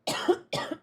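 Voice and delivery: sudden cough, something down the wrong pipe; choking cough. Real, not acted. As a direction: cough hard after swallowing wrong. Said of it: "Something went down the wrong pipe".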